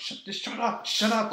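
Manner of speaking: high, urgent hushing mom; frantic mom. Retold or imitated. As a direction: shush the kid fast before anyone notices. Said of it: "And my mom's like".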